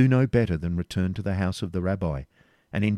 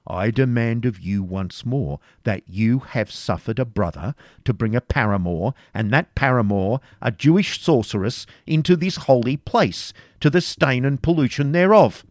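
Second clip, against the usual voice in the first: builds to a loud, much higher outraged charge; rising accusation; 5 semitones higher, 6 dB louder than usual. A narrator's reading. Grand Master accusing the preceptor, growing furious. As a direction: climb from stern to furious.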